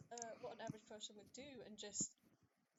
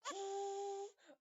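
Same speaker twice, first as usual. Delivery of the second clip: long, loud, high "hoo"; train whistle imitation; 10 semitones higher, 7 dB louder than usual. Shown as a demonstration. Player imitates Nanny's fuming, as a train hoot. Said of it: "of a train noise coming out".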